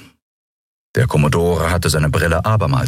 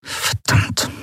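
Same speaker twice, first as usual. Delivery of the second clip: breathed, voiceless curse; quietly; 3 dB quieter than usual. A narrator's reading. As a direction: swear under the breath. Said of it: "sagte Monaro leise".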